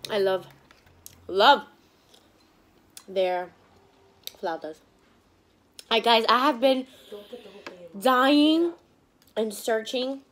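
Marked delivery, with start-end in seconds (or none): 0.1-4.8 words muffled by chewing; chewing while talking. Real, not acted. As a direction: mouth partly full of food. Seen 1: Lips pursed, cheeks working after bite, fork in hand.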